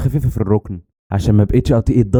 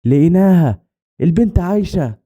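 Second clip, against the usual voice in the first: high, raised call of discovery; shouted announcement; 8 semitones higher, slower than usual. A narrator's reading. Rescuer calling out that the girl is alive, mild shout. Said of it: "حد كان بيصرخ وبيقول".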